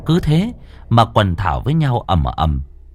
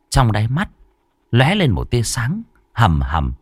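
plain reading voice

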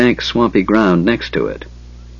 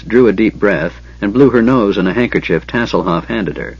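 ordinary delivery

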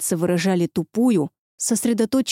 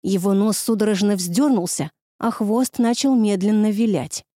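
ordinary delivery

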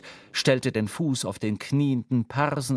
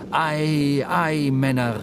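louder call to the men; 5 dB louder, slower than usual. A narrator's reading. Ahab rouses his crew, narrated louder call. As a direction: narrator calls as Ahab, a touch of rousing. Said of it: "rief Ahab".

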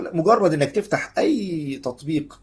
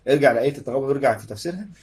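ordinary talk

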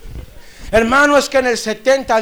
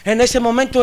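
usual speaking voice

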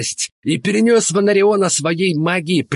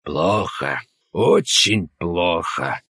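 lower, slow heavy answer; grim; 10 semitones lower, slower than usual. Acted. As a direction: weigh each word with grief. Grim report on dying man.

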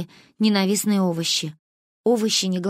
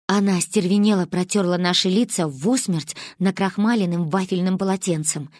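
ordinary delivery

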